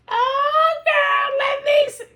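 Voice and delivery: high squealing girl-greeting impression; mock squealing greeting. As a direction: shriek it like friends who just met again. Mocking women greeting again.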